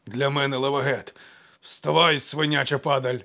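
loud, rough urging; insistent shout. Acted. Old servant shouts at his fallen master to stand up. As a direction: bark it at him to get up.

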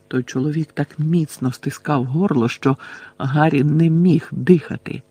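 ordinary delivery

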